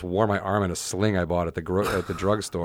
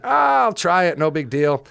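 breezy bravado; 8 semitones higher, 5 dB louder than usual. Retold or imitated. Acting out casual bravado before the hill.

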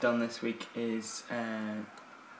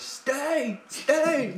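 put-on a much higher, louder child's shout up the stairs; toddler shouting; 5 dB louder than usual. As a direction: yell "Stay" up the stairs like a little girl. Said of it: "she shouted up the stairs".

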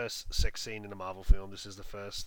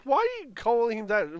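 confused; 13 semitones higher, 4 dB louder than usual. Retold or imitated. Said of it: "Oh, I'm like".

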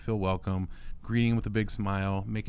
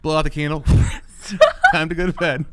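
higher and louder, a hammed-up old-time mother; mock Waltons parent; 6 semitones higher, 10 dB louder than usual. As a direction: tuck the kids in, half laughing.

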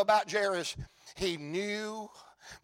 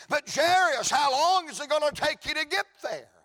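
pressing bystander; 6 semitones higher than usual. Acted.

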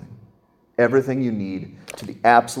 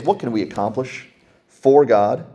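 ordinary delivery

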